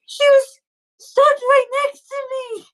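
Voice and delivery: very high, tearful wail; crying little girl. Acted. Little girl pleads with boy about lost friend, crying. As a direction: bawl it like an upset child. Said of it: "What are you crying for?".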